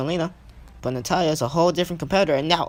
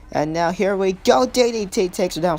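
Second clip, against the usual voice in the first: excited move call; 3 semitones higher, 4 dB louder than usual. Real, not acted. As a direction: stretch out the build-up with rising excitement. Commentator calls the finishing takedown.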